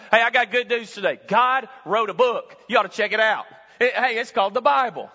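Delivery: loud, fast, fired-up preaching; full-force preaching. Acted. Preacher rousing the church, loud and fast. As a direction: sell the good news to the crowd.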